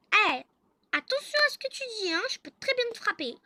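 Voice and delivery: high, loud, rapid telling-off; threatening warning. Acted. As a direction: snap back as an outraged wife. Wife threatens grumbling husband. (menace criée, aiguë et rapide)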